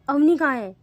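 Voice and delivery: loud sharp demand; demanding loudly. Acted. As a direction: bark out the question. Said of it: "पायल और कहती है".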